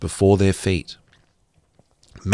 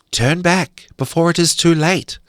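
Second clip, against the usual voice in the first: high piping bird voice; piped high; 9 semitones higher than usual. A narrator's reading. Birds and insects warning the girls, high piping. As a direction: pipe it up high like small birds. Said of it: "piped the birds and insects".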